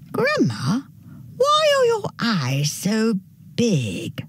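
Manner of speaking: put-on high, slow girl's question; wondering little girl. A narrator's reading. Girl questions her strange-looking grandma, high wondering voice.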